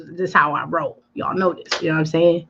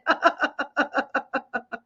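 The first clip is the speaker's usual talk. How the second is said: laugh; 5 semitones higher, 7 dB quieter than usual. Real, not acted. Laughing at commenter name.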